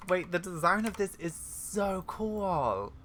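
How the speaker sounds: high, slow, drawn-out gushing; thrilled, lingering on the last words; awestruck excitement. Real, not acted. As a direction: stretch out the admiration. Admiring a design in the game.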